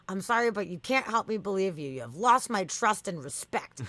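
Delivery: hammed-up chatbot telling-off; indignant scolding. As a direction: slam down each accusation as the offended bot. Acts out chatbot's angry rejection.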